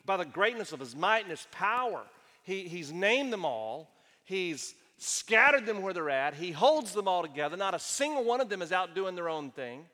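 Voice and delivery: rising preacher's fervor; preaching. Acted. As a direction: build the sermon to a loud climax. Preaching about God's power.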